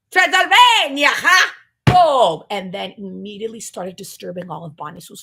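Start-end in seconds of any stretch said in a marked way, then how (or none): 0.1-2.4 much higher and louder, slow; hammed-up Transylvanian proclamation; triumphant shout. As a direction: crow it like a proud Transylvanian.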